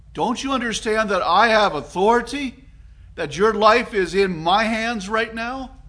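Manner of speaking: a frustrated judge demanding an answer; exasperated Pilate. Acted. Judge pressing a silent prisoner, rising exasperated demand. Preacher voices Pilate questioning Jesus.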